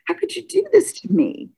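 high, pained retold cry of hurt; plead, voice lifting in hurt; hurt plea. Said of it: "Like, I just felt".

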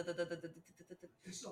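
usual speaking voice

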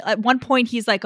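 ordinary delivery

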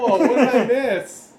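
loud, high, drawn-out surprise; startled waking. Acted. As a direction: play someone jolted awake, slow and high. Sleeping character wakes up startled.

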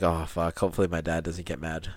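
low, quiet worry to oneself; lightly voiced worried kid, under the breath; worried muttering. Voicing worried son to himself.

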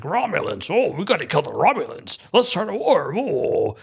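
mock alarmed hothead, pitch swooping high; Ortega. Mocking a crew member traumatized by Romulans, exaggerated alarm. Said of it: "she has to be the one who's like".

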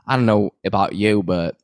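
light Southern belle voice; Southern accent. Retold or imitated. Mimicking Sutton in a light Southern accent. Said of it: "You have a good Southern accent".

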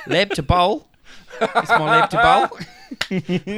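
high, loud mock Kiwi; New Zealand accent. Joking about New Zealand's farm to table, hammed-up Kiwi accent. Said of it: "New Zealand's farm to table is".

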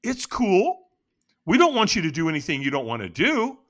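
high, easygoing placating voice; lifted, let-it-go reassurance; soothing stranger. Retold or imitated. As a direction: imitate a stranger backing off gently. Stranger soothing him in retold scene.

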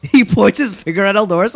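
ordinary talk, nothing marked

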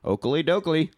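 lightly voiced cheery Flanders cop; a hint of a chipper neighbourly cop; mild goofy Flanders lilt; Ned Flanders cop. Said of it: "Ned Flanders of cops".

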